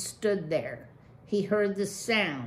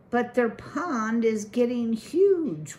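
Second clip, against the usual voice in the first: put-on objecting child; 6 semitones higher, 5 dB louder than usual. A narrator's reading. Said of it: "one of the children objected".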